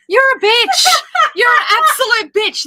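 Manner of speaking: high, loud shout after a fright; startled shout. Real, not acted. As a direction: yell at the daughter after a scare. Daughter startled her on camera.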